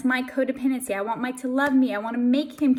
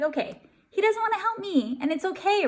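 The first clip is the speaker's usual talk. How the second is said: higher-pitched, easygoing acceptance; accepting self-talk; 7 semitones higher than usual. Retold or imitated. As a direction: reassure yourself that it's fine. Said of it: "I'm just like".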